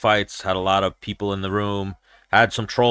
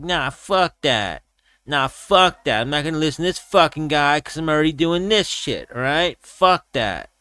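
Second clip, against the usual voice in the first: Diaz brother; 5 semitones higher than usual. Retold or imitated. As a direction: put on a Diaz-style fighter accent. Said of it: "talking like a Diaz brother".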